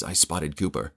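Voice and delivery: ordinary delivery, nothing marked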